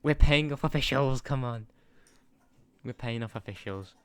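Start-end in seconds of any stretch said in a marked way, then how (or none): 0.0-1.7 much louder, higher hammed-up indignation; louder, higher mock protest; mock outraged shout. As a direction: shout it like a cheated fan, half joking. Mock outrage at game referee.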